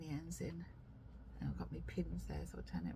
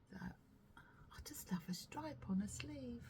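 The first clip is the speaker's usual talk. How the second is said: 1 whisper; 3 semitones higher, 8 dB quieter, slower than usual. Real, not acted. Whispering about the sleeve.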